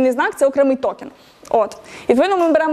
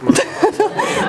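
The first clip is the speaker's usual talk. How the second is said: a real laugh that jumps high; high burst of laughter. Real, not acted. Teacher hears a student's odd translation, high real laugh.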